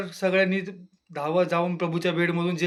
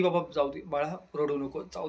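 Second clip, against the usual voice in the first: consoling; 3 semitones lower, 6 dB quieter than usual. Retold or imitated. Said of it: "Deepali तिची समजूत काढायला आली".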